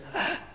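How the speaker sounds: sharp high mock gasp of shock; imitated gasp. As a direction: act out her startled intake of breath. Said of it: "And she went".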